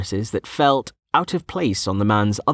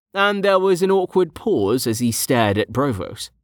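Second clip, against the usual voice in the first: usual speaking voice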